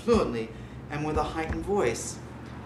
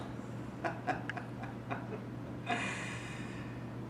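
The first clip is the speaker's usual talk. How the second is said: sinister laugh; 5 semitones higher, 12 dB quieter than usual. Acted. Narrator's sinister laugh after confession.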